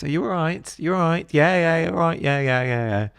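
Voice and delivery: breezy small talk. Retold or imitated. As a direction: brush it off cheerily, on autopilot. Mock breezy British small talk.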